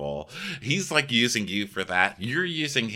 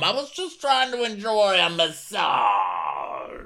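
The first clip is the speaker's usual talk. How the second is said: high, slow whine; whiny Gary; 9 semitones higher, slower than usual. Retold or imitated. Host mocking Gary complaining to Tom, high drawn-out whine. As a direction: whine like a put-out man-child.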